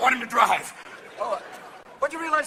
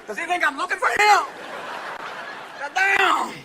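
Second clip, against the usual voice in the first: hammed-up affronted outburst; 5 semitones higher than usual. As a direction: exclaim in comic disbelief. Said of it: "Even [name]'s going".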